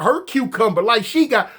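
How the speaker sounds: ordinary talk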